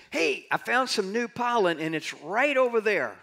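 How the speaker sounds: high-pitched, eager announcement; excited bee. Acted. Bee telling the others about new pollen, excited call. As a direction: call out the find to the hive. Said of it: "So that bee is saying".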